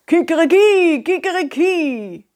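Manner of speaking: high rooster cock-a-doodle; rooster crow. Acted. Rooster neighbour crows.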